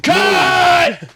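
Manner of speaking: huge, high-pitched yell; director's full-blast shout; megaphone scream. Retold or imitated. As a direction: bellow it like a furious director. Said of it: "he's screaming through the megaphone".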